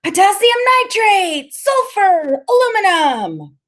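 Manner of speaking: very high, loud sustained cries; high-pitched, excited calling out; exultant shouting. A narrator's reading. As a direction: yell each one like a thrilled kid. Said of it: "Freak keeps on shouting out the names of chemicals".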